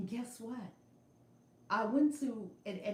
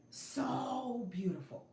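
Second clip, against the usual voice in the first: "So beautiful" voiced slowly, tenderly moved; voiced awe; 4 semitones higher, slower than usual. Real, not acted. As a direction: let "So beautiful" come out slow and tender.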